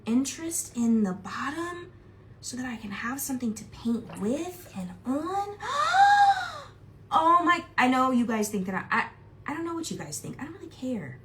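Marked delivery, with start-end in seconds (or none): 5.6-6.6 excited gasp. Real, not acted. Thrilled gasp.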